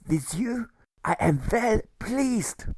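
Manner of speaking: high, gentle voice of the good German; fond fatherly German. A narrator's reading. The good German telling Alfred he is like a son. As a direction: speak to him like a beloved son.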